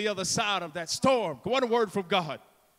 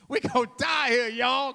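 raised, wailing comic alarm; hammed-up frightened cry; mock panic; 4 semitones higher, 4 dB louder than usual. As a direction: warn them in pretend terror. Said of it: "they screamed out with fright".

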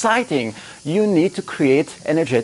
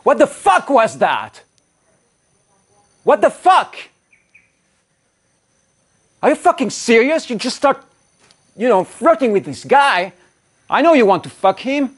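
higher, louder furious accusing yell; jealous rage; 8 semitones higher, 4 dB louder than usual.

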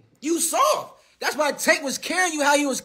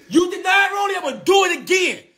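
shouted challenge; 7 semitones higher than usual. Real, not acted. Calling out rival by name.

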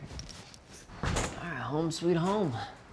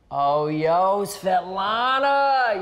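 calling out; 5 semitones higher, 5 dB louder, much slower than usual. Real, not acted. Calling his wife over.

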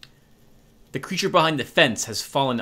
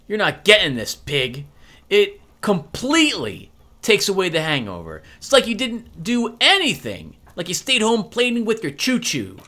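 put-on higher, scornful kid voice, louder at the insults; mocking kid; 6 semitones higher, 4 dB louder than usual. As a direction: sneer at the stupid cop. Kuno lectures the cop.

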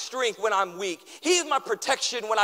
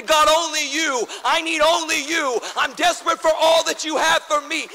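desperate cry; 6 semitones higher, 5 dB louder than usual. Acted. Preacher voicing desperate prayer.

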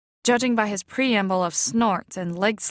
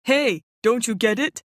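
uncle nudging; 3 semitones higher, 3 dB louder than usual. A narrator's reading.

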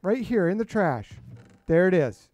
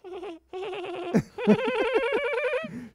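silly laugh. Pretend. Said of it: "come over to my mic and laugh".

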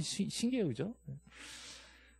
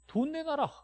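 mock demand; 12 semitones higher, 4 dB quieter, slower than usual. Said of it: "상대방은 뭐라 그래".

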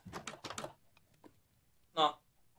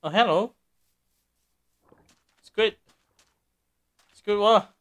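loud calling out a name; 5 semitones higher, 11 dB louder than usual. Real, not acted. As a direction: shout the name to get attention. Calling out to a character.

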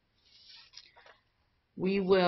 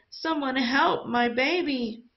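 high, desperate plea for help; pleading cry; 8 semitones higher than usual. A narrator's reading. Mother begging the villagers, high pleading cry. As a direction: beg for your child's life. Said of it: "his mother cried".

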